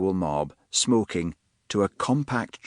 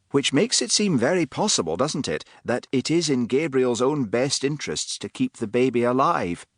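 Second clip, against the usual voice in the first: raised, reasoning man's voice; Gerrit, dry; 6 semitones higher than usual. A narrator's reading. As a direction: point it out with dry patience.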